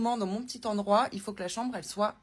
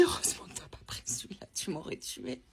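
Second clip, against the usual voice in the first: whispered quote; 4 semitones lower, 8 dB quieter than usual. Retold or imitated. Friend's gossip retold, secretive quote.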